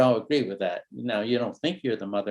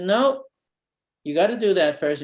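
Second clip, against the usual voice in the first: a mom's sharp no, much higher, then settling; mother's voice; 6 semitones higher, 4 dB louder than usual. Retold or imitated. Mom denying the son's request, sharp no. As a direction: mimic a mother refusing firmly. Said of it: "She said".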